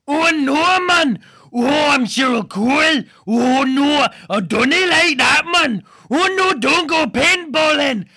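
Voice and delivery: high, loud mock Geordie girl; hammed-up Geordie whine. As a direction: squeal it like a whiny pop star. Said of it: "I do a cover of [name]".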